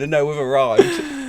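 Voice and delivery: slow, grand announcer voice; grand announcement. Retold or imitated. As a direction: announce the arrival slowly like a star. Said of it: "putting on the story saying".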